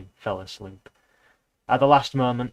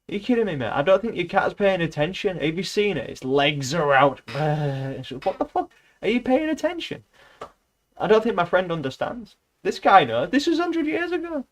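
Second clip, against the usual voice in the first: incredulous rant; 7 semitones higher than usual. Real, not acted.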